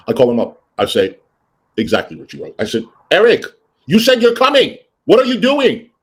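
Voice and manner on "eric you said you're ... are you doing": high, raised exasperated demand; retold scolding. Boss calls housekeeper who never came, exasperated scolding. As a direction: call him out, fed up.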